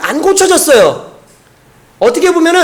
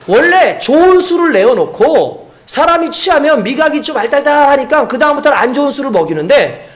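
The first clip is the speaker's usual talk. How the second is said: usual speaking voice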